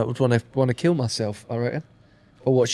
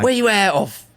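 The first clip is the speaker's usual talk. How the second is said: gruff cockney hard man; essex accent; 4 semitones higher than usual. Retold or imitated. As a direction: ask it like a prison hard man. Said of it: "like you coming out of prison".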